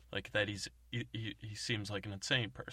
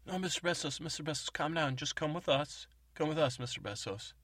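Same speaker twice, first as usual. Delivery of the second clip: soothing; 7 semitones higher, 4 dB louder than usual. Retold or imitated. Agents soothe panicking man.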